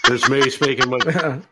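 put-on actor voice breaking into laughter; playful celebrity impression, laughing; Nicolas Cage. Demonstrating the top accent, laughing Cage voice. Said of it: "speaking with the Cage accent".

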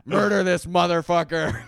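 very loud, high bellowed order; drunk furious shout. Pretend. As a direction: roar it at the dog. Drunk dad command riff.

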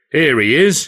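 mildly voiced welcoming boss; lightly voiced hearty boss. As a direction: greet him like a jovial governor. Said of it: "he said".

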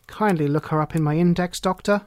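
usual speaking voice

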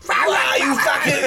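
high, slow laughing yell; football hooligan shout. Retold or imitated. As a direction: bellow like a drunk hooligan. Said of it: "football hooligan stuff".

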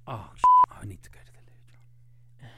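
fast urgent whisper; hurried whisper. Real, not acted. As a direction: whisper it quickly, off mic. Hurried aside mid-broadcast.

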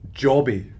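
put-on broad Scottish accent. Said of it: "Thick Scottish accent".

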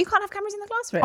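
high fast exclaim. Real, not acted. Friend insisting on no classroom cameras. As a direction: blurt it out insistently.

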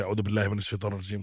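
a hurried whispered-down recitation; rushed protective prayer. Retold or imitated. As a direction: rattle it off quickly to ward off evil. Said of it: "we start to panic".

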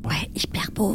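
hushed breathy whisper. Acted. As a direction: keep it under your breath. Hiding from guards, whispering.

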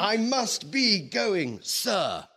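high, loud, slow, fed-up cry; exasperated outburst. Acted. Bond bursts out at his boss, fed up. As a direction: blurt it out at the end of your tether. Bond losing patience, trying to leave.

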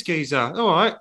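put-on London geezer accent; lightly played Cockney geezer; Dave's geezer voice. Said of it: "Dave talks like this".

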